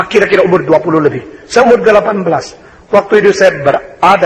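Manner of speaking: ordinary talk, nothing marked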